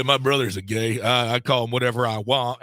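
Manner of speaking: mock Southern good-ol'-boy quote; hammed-up Southern accent for the quote. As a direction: put on a joking Southern twang. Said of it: "why I'm making Southern".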